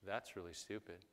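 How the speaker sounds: low, muted dismissal; quiet deadpan aside; skeptical onlooker. Acted. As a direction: mutter it as a doubting bystander. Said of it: "people that went".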